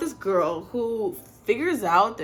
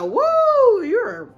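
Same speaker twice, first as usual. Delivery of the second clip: mock starstruck woo; 9 dB louder than usual. Guy stunned by gorgeous girl.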